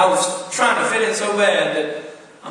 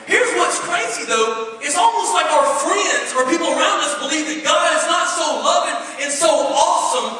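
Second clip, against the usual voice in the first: loud, high, fervent preaching; full-force preaching; 9 semitones higher, 7 dB louder than usual. Acted. Preacher rousing the congregation, loud and high. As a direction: preach it hard to the back row.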